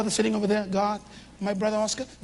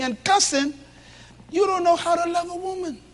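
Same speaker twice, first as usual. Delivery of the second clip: full-force preaching; 8 semitones higher, 3 dB louder than usual. Acted. Preacher warning the men about their fathers, full force. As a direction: drive it home to the congregation.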